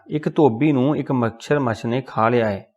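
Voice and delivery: ordinary delivery, nothing marked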